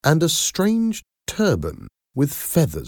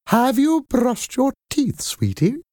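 put-on high, sweet old nanny; kindly nanny voice. A narrator's reading. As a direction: coo it like a kind nanny. Madam Doubtfire voiced.

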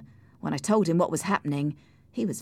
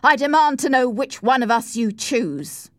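loud, raised-voice ultimatum; angry demand; 7 semitones higher, 7 dB louder than usual. A narrator's reading. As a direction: demand an answer forcefully. Edgar's angry ultimatum to Cathy.

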